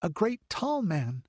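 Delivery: put-on a wide-eyed girl recounting the stranger; little girl's tale. A narrator's reading. Child tells what she saw.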